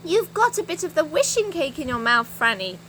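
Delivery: an urgent shout. Acted. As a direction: call it out urgently. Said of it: "shouted Moonface".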